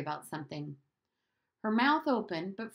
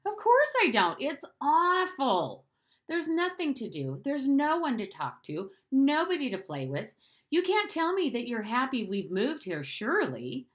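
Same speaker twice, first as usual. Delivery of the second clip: high, loud protest; a boy venting everything he hates; indignant boy; 7 semitones higher, 4 dB louder than usual. Acted. Bruno protests to Maria, high and loud.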